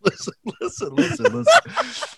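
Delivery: high, breaking into laughter; laughing. Real, not acted. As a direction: plead for attention while laughing. Laughing while insisting.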